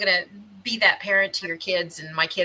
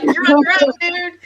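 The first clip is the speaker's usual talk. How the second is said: loud, high, laughing disavowal; hammed-up cry of every man for himself; mock warning; 9 semitones higher, 8 dB louder than usual. Acted kids abandoning the culprit.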